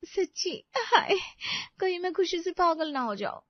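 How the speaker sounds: high-pitched, thrilled disbelief; overjoyed. Acted. Wife told she will go with him, overjoyed.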